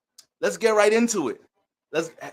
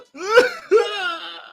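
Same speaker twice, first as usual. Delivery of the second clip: high, squealing laugh; real laugh at your own joke; high-pitched laugh; 14 semitones higher, 4 dB louder than usual. Real, not acted.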